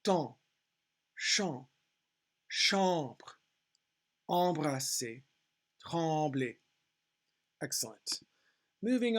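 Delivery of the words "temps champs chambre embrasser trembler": "Temps, champs, chambre" each alone, falling, nasal AN; French teacher's careful word list; phonetic reading. Shown as a demonstration. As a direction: read out "embrasser, trembler" with a French nasal AN. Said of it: "listen to me read it".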